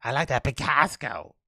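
put-on Boston accent; mock Boston-accent impression. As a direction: play a Bostonian with flattened a's. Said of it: "sounds like somebody in Boston".

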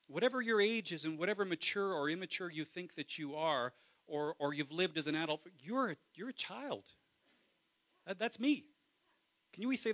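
loud, passionate preacher voice; preacher at full force; preaching. Acted. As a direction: preach it hard to the congregation.

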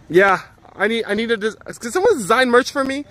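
pleading for help with merch; excited plea. Real, not acted. Streamer eagerly begging chat for merch design, excited. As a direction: voice jumps high, eager ask to chat.

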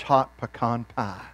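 ordinary delivery

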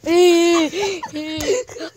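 shrieking laugh while tickled; helpless ticklish squeal; being tickled. Real, not acted. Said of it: "Please don't tickle me hard".

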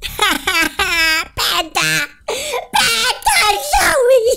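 high calls broken by laughter; laughing shout. Real, not acted. Gamer laughing while calling for Panda, high laughing shout. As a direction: giggle while yelling the name.

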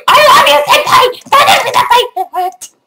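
squealing high anime fangirl; overacted anime dub. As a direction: shriek it like a lovestruck fan. Anime senpai bit.

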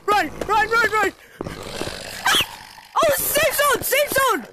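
high loud frantic yelling; panicked shouting. Real, not acted. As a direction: yell while fleeing for safety. Fleeing then reaching safety.

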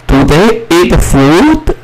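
high, slow questioning read; slow model question. Shown as a demonstration. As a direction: ask it slowly for the class. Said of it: "我们再念一遍". (高音慢速示范问句)

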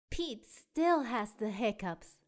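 plain reading voice

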